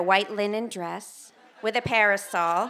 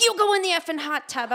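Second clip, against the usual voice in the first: a mock snap, pitched up; snippy, higher retort; 6 semitones higher than usual. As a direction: bite back at the husband, half joking. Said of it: "I'm like".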